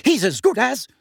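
high, loud fierce retort. A narrator's reading. Mother insisting the boy is like her son, fiercely. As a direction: fire back fiercely. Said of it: "said Missus Weasley fiercely".

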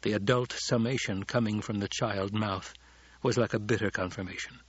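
plain reading voice

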